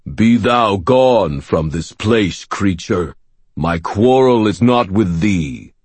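slow, commanding archaic voice; deep resonant voice. Acted. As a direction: speak like an ancient giant. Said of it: "a very deep, resonant voice said".

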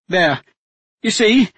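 a hostage duchess coolly taking charge; steady duchess voice. A narrator's reading. Captive duchess telling the men to make a deal, steady and firm.

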